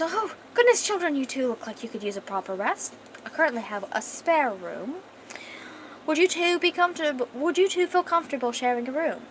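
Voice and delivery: put-on posh accent with a high, fluting opening; posh queen. Posh queen offers tired guests a spare room, in posh tones. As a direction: play a gracious, posh monarch. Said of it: "like I said, she's a posh bitch".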